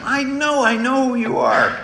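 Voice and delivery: high, pushy insistence, voice lifted; mock-confident stranger who won't be told; insistent stranger. Stranger at the airport insisting he knows him, high and pushy.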